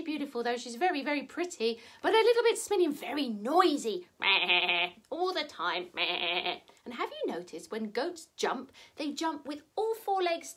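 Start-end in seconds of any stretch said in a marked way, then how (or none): 4.1-4.9 low wavering goat bleat. Acted. Storyteller shows how noisy the goat is, bleating. 5.9-6.6 low, softer wavering goat bleat. Acted. Storyteller demonstrates the smelly, noisy goat, bleating.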